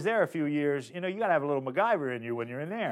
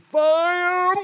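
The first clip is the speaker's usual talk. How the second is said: high strangled yell of fire; trying to yell fire without the police hearing; muffled high scream; 7 dB louder than usual. Retold or imitated. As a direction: scream it high but hold it in. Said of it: "like this, 'cause I didn't want the police to hear".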